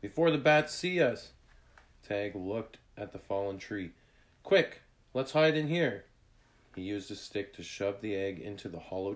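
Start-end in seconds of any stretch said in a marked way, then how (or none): 0.0-1.3 high, loud and urgent; raised voice, pressing to act; urgent warning. A narrator's reading. Urgent warning while fleeing bats. 4.4-6.1 high, loud, hurried urging; raised voice with sudden urgency; urgent call. A narrator's reading. Urgent call to hide from bats.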